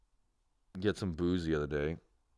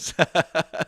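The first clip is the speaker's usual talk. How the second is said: high mocking laugh; 8 semitones higher, 6 dB louder than usual. Real, not acted. Taunting absent friend Clayton.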